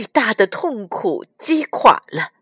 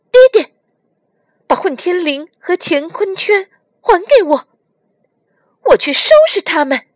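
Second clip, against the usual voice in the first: put-on high, urgent child's voice; pleading boy; 10 semitones higher, 6 dB louder, faster than usual. A narrator's reading. Nezha begs his father for his weapons, pleading. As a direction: beg father loudly in a high boyish pitch. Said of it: "哪吒恳求李靖".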